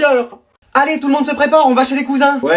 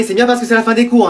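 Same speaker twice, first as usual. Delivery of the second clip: normal talking voice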